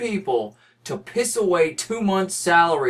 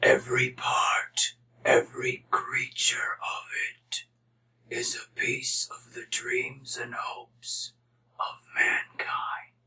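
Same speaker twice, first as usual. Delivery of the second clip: slow, hushed ancient whisper; whispery mystical voice; 3 semitones lower, 6 dB quieter, slower than usual. Pretend. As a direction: breathe it like a wise old oracle. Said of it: "me doing a voice".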